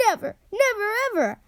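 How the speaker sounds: high tearful wail. A narrator's reading. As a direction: cry it out, overwhelmed. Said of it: "he wailed".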